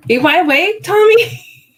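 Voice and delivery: high, loud sing-song call; playful wake-up call. Real, not acted. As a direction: call out to rouse him.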